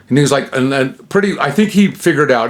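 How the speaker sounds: usual speaking voice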